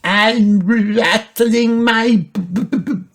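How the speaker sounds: put-on high child voice, rattling stutter into loud bones; rattling Peppa. A narrator's reading. Peppa shakes as a skeleton, high rattling stutter. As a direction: rattle the b's, then burst out high.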